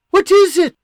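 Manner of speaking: a mother jolted by fear; frightened cry from the kitchen; alarmed. Acted. Mother alarmed at son's call.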